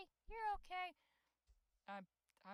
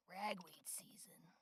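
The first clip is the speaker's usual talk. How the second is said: hoarse whisper; 2 semitones lower, 5 dB quieter, much slower than usual. Real, not acted. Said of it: "Morning voice".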